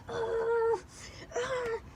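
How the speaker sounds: high frustrated ugh; frustrated groan. Retold or imitated. Teacher groaning in frustration at the class, high exasperated ugh. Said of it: "he was like".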